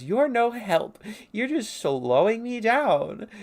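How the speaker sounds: high, loud, drawn-out scolding; acted exasperation. Blaming a companion for slowing the search, exasperated scolding. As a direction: complain at full cartoon pitch. Acted quarrel between characters.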